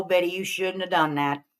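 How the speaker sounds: usual speaking voice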